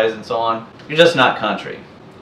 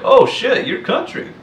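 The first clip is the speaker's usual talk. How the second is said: higher, imitating a friend's sudden realisation; mock surprise; 12 semitones higher than usual. Friends finally realise the speaker is country, imitated surprise. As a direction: act out a pal's surprised discovery. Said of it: "and they're like".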